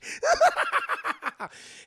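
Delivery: very high, loud villain laugh; hammed-up Joker laughter; maniacal cackle. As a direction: cackle wildly in staccato bursts.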